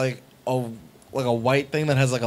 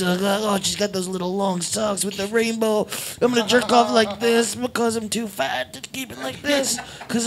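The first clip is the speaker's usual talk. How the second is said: guy alone at home; 3 semitones higher, 4 dB quieter than usual. Acted. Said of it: "some dude at home like".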